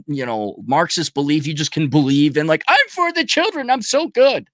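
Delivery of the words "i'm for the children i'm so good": high, smug self-praise; a smug activist mocked in a high voice; mocking smug activist. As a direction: preen like a do-gooder, much higher. Mocking virtue signallers.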